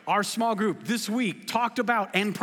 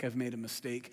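rueful regret; 6 semitones lower, 6 dB quieter than usual. Retold or imitated. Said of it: "you're like".

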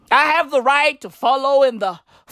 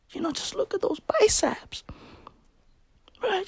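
breathy whisper; 6 semitones higher, 10 dB quieter than usual. Acted. Voicing Chris Pratt's lustful musing.

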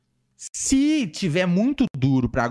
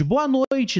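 excited greeting; 11 semitones higher than usual. Real, not acted. Greeting a viewer on stream.

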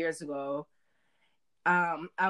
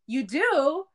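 higher, louder surprised question; surprised aunt; 8 semitones higher, 6 dB louder than usual. Retold or imitated. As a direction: ask it caught off guard. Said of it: "she was like".

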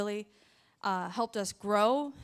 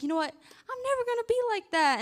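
high, emphatic mock vow, stress on never; swearing she'll never be that wife, high and emphatic; 12 semitones higher than usual. To herself, vowing she'll never be like that, high and emphatic. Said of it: "And I was like".

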